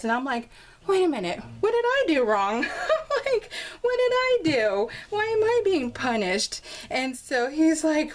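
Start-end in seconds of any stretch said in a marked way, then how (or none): 0.9-2.6 high-pitched, half-laughing complaint; mock indignant protest. Acts out her mock protest. 3.8-6.6 very high, plaintive mock complaint; mock wronged protest. Acts out her mock protest.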